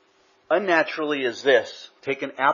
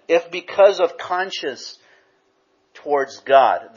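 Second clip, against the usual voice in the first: slow, weighty reading of because of conscience towards God; emphatic slow quote; 4 semitones higher, 5 dB louder, slower than usual. Shown as a demonstration. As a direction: read it slowly so they notice. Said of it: "I want you to notice something in that statement".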